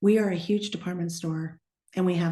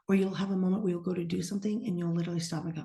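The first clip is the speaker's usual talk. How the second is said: usual speaking voice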